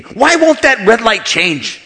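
loud, high, drawn-out fuming; mock road-rage yell; mad outburst. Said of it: "You get mad too quick".

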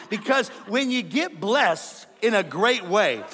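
usual speaking voice

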